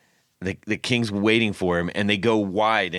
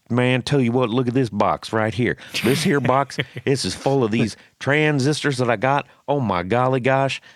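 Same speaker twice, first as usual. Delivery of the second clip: put-on higher-pitched comic Southern good ol' boy; mock Southern accent; 4 semitones higher than usual. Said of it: "I'll do a Southern accent just to make fun".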